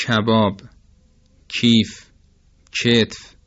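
"کباب، کیف، کتف" with k from mid-mouth; Persian palatal kaf. Shown as a demonstration. Teacher showing Persian kaf, mid-mouth k in "کباب، کیف، کتف". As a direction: separate words, each k fronted and palatal in "کباب، کیف، کتف".

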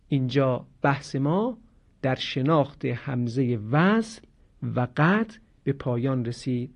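ordinary talk

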